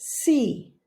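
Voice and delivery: ordinary delivery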